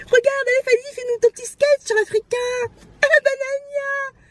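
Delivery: high, squealed imitation of an egging-on relative; mocking family cheerleading. Imagined family asking kid for his sketch, mock-excited.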